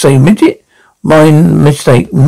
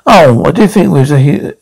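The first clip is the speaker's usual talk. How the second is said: normal talking voice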